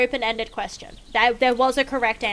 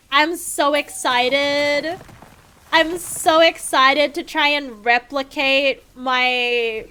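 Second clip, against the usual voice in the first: slow, louder mock enthusiasm; sarcastic excitement; 4 dB louder, slower than usual. Gamer dreading copying the build, mock excited. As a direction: oversell the excitement, dripping irony.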